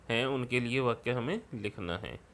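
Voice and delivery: ordinary talk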